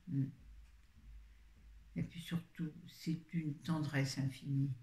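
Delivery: normal talking voice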